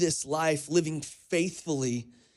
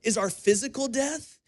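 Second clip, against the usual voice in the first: raised, high preaching voice; 6 semitones higher than usual. Acted. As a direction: preach it with rising conviction. Preaching on eternal reward.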